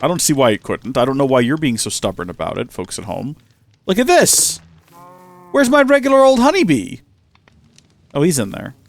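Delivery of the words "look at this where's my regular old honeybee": much higher, louder startled cry; sudden panic over a missing pet; hammed-up alarm at the empty hive; alarmed outburst. Real, not acted.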